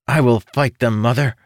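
put-on high, firm childlike declaration; young declaring. Young son declaring to his mother, brave and high.

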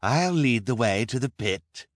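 theatrical villain taking the lead; Count Olaf announcing. A narrator's reading. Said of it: "announced Count Olaf".

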